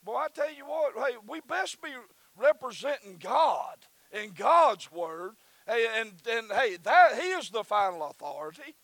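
loud, high preacher's cry; full-voiced revival preaching; preaching at full force. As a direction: lift the congregation, shouting the word.

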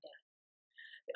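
a soft whispered word to a dying father; whispered goodbye. Retold or imitated. Son at his father's deathbed whispering softly. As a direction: breathe the word gently at a bedside. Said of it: "talked to him and said".

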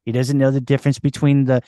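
hammed-up Boston local; thick put-on Boston accent, street-corner chatter. Shown as a demonstration. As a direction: rattle on like a guy from Boston. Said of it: "Boston, take, take one, action".

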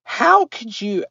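can't believe what you heard; incredulous. Retold or imitated. Outraged at someone mangling her name, incredulous. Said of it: "and I was just like".

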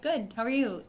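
high, chirpy reflex answer; a light mock cheerful reply; automatic reply. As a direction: toss it back on autopilot. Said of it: "it's just automatic".

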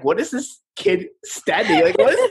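put-on laughing bystanders wondering about the weird kid; mock bewildered onlookers. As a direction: imitate gawking strangers, amused. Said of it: "they're like".

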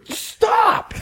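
sudden very high-pitched yell; retold shout. Man being bitten by a woman, exasperated shout. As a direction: burst out with one high shouted command. Teller yelling at the biting woman.

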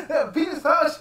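high, loud words through laughter; laughing incredulous. Real, not acted. Repeating the friend's line while laughing hard. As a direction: squeal it out while cracking up.

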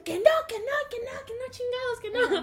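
high, insistent mock shouted refusal; acting out exasperated repeated no; retold yelling. Teacher refusing the begging student, high mock yelling. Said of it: "se estaban gritando de que".

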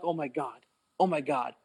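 soft, understated "caught out" murmur; quiet mock panic under the breath; retold hushed dread. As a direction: imitate hiding, voice dropped. Retold hiding behind wall from coach.

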